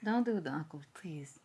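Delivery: hushed, unvoiced plea; whispering. Real, not acted. Pleading in hushed tone.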